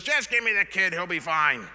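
loud, high-starting imitation of a brisk old lady; old nursery lady. Old lady at the chaotic nursery, imitated loud and brusque. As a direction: blurt it out loudly, dropping in pitch. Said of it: "there's this old lady behind the thing".

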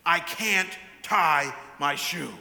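loud, slow imitation of a wailing toddler; retold scream, each word hit hard and drawn out; mock screaming. Father imitating his daughter stuck on her shoe, mock screaming. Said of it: "looking at her shoe screaming".

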